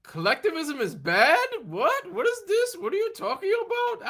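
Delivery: high disbelieving voice; terrible impression of Anis. Imitating a friend baffled by Ayn Rand talk, high and incredulous. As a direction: squeak out the outrage. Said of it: "I'm doing a terrible impression".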